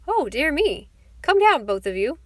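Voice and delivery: high, loud startled summons; calling out. A narrator's reading. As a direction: call up to them loudly. Grandfather calls up a command.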